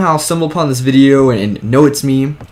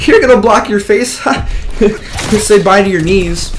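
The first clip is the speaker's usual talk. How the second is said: high, cocky taunting jab at the opponent; 7 semitones higher than usual. Retold or imitated. To the kid in fighting stance, a high cocky laughing taunt. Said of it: "I'm like".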